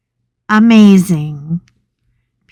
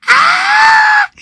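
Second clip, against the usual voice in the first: very high mock scream; imitated scream; 4 dB louder than usual. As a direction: shriek like someone watching horror. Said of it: "He was screaming".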